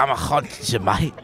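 hushed, throaty stab at Liverpool; throaty Scouse attempt. Host retries Liverpool ghost after coaching, throaty Scouse attempt. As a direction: put a harsh "ha" into a bad Scouse accent. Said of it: "like, ha to it".

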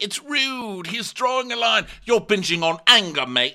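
high-pitched hammed-up scolding; mock indignant rant. Acted ranting insults in quarrel.

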